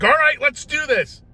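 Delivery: high, loud, gung-ho; all ramped up. Retold or imitated. Doctor coming over to start, ramped-up loud cheer. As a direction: burst out pumped and ready to go. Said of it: "right, all ramped".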